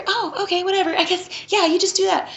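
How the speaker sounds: high, soft mocking voice for the doctor; mock limp reply. Reviewer mocks the doctor's limp reply, high and soft.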